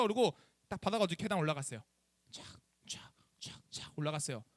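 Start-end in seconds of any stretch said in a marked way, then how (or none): 2.9-3.6 soft, breathy swish of footsteps; whispered swish. Retold or imitated. Pastor mimics climbing the Starbucks stairs, whispered swish. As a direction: whisper the quick steps up the stairs.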